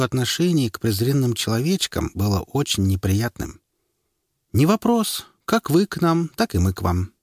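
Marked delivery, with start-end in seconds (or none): 4.5-7.1 высоко и надменно, с вызовом; бросить свысока, как гордая эльфийка; надменная эльфийка. A narrator's reading. Презрительный ответ эльфийки. (надменный голос эльфийки)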